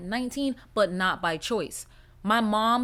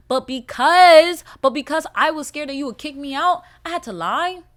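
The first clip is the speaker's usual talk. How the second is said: heated protest; 4 semitones higher, 9 dB louder than usual. Real, not acted.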